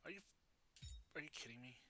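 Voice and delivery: ordinary talk, nothing marked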